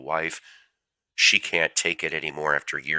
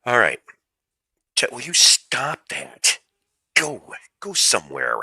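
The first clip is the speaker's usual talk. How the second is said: louder, much higher snap at the cat; exasperated scolding; 9 semitones higher, 5 dB louder than usual. Real, not acted. Scolding his pestering cat, exasperated and loud. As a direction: shoo the pest off, fed up.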